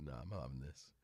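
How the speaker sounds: lightly voiced grumpy cat; voicing the cat. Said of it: "Tom was just like".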